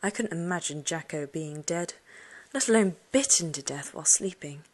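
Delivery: usual speaking voice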